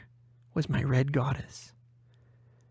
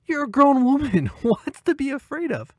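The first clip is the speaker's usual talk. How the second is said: 1 teasing laugh; 12 semitones higher, 5 dB louder than usual. Acted.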